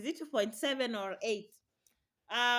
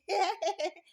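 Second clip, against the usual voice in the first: laugh; 7 semitones higher, 4 dB louder than usual. Real, not acted. Laughing at the memory.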